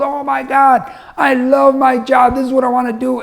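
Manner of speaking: high, elated imitation of a thrilled son; overjoyed. Son back from first day at work, overjoyed. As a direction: gush with delight, drawing out the love. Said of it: "he's the happiest kid in the world".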